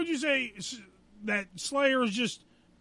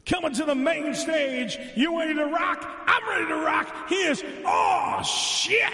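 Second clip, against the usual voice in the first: practice stage announcer; 2 semitones higher than usual. Pretend. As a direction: hype the crowd, stretching the band name. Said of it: "Wait, let me practice".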